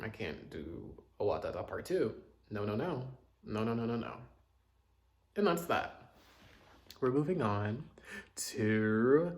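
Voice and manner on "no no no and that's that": ordinary talk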